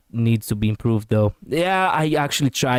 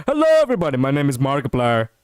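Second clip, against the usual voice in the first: hamming up the Markiplier intro; Markiplier impression; faster than usual. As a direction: do the big YouTuber hello. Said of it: "let me do that with the actual, you know, Markiplier".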